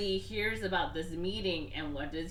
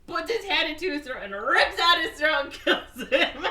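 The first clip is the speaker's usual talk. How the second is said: loud, higher-pitched gleeful telling; excited climax; 9 semitones higher, 8 dB louder than usual. Real, not acted. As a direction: build to the gory punchline, breaking into laughter. Teller's climax of the gory fight.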